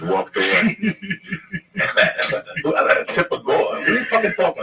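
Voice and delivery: laughing through the words, high and loud. Real, not acted. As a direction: crack up at the punchline. Laughing at his own punchline.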